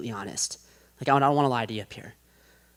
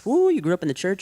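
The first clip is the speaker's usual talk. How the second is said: normal talking voice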